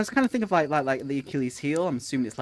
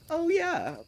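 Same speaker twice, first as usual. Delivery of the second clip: sudden recall; 13 semitones higher than usual. Retold or imitated. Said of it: "and I'll be like".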